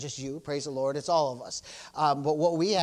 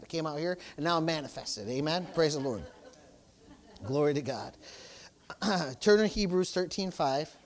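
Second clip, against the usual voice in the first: ordinary talk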